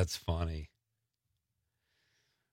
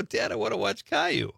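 put-on higher-pitched kid voice, insisting; imitated young son; 7 semitones higher than usual. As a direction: mimic a child pestering Dad.

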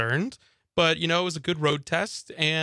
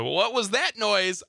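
raised, drawn-out startled question; mildly worried; 6 semitones higher, slower than usual. Acted. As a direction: act out a touch of alarm at a noise. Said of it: "I just start worrying".

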